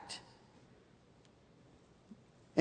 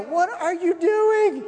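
building higher and louder, slowing to the end; panicked; 9 semitones higher, 5 dB louder, slower than usual. Retold or imitated. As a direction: talk yourself out of it in a panic. Retelling his own panic.

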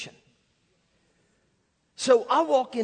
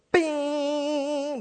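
higher, uneven held bing sliding down; out-of-tune string ring; 6 semitones higher than usual. Shown as a demonstration. Showing what the piano no longer did, wavering bing. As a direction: ring out like a string still off pitch. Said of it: "until it was no longer".

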